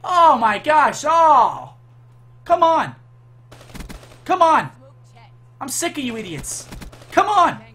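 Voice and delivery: high, loud furious shouts; angry yelling. Real, not acted. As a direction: bark taunts at the enemies. Yelling at enemy players.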